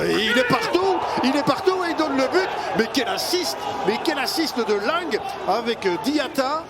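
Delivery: high, thrilled outburst; excited shout. Real, not acted. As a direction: shout it in delight at the pass. Excited call of an assist.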